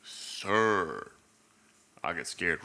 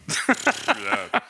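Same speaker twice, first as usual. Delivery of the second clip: loud laugh; 13 semitones higher, 6 dB louder than usual. Real, not acted.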